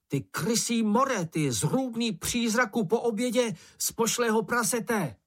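high-pitched stream of mock abuse; hollering insults. A narrator's reading. Mumínek tries to enrage the bush, high hollered abuse. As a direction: pile up the insults, voice held high. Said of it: "zahulákal Mumínek".

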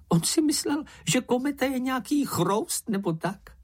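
very high, squeaky mocking voice; high-pitched little creature making fun; amused creature. A narrator's reading. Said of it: "smál se čenich".

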